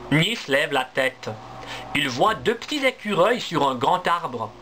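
ordinary delivery

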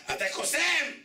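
high-pitched, squeaky exclamation; mock-excited high cry; excited challenge. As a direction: leap way up high, calling out a challenge. Acts someone challenging the rabbi.

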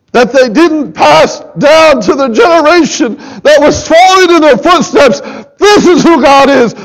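rising, fervent sermon voice, pleading with the church; full-force preaching. Acted.

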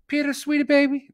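high, loud caricature of a wife; mock sweet-talking wife. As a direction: put on an exaggerated sugary wife voice. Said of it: "She doesn't talk like that".

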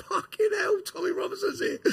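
high, excited howl of the wing; mock inmates howling. Inmates on the barriers howling at his arrival, high and excited. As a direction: ham up the whole wing going mad. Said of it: "everyone's howling".

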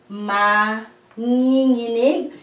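"manginginig" slowly, drawn out as ma, ngi, nginig; "manginginig" in slow, separated syllables. Shown as a demonstration. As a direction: stretch each syllable of "manginginig" for learners. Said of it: "when you go by syllable".

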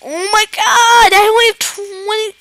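high, loud alarm; real panic. Real, not acted. Gamer sees only twenty left, panicked. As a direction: cry out in sudden high-pitched panic.

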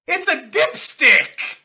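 loud, high, drawn-out shout; incredulous exclamation. Real, not acted. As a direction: crow it out slowly in gleeful disbelief. Co-host exclaims over the medical method.

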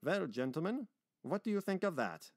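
high, lifted challenge; challenging. Acted. Meyer asking the gentlemen after the terrible story, challenging. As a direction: put it to them pointedly.

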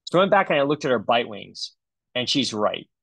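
normal talking voice

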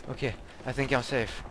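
ordinary talk, nothing marked

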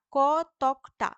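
slow, emphatic teacher's split of কতকটা; syllable by syllable. Shown as a demonstration. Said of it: "এই তকটা হচ্ছে রুদ্ধ দল".

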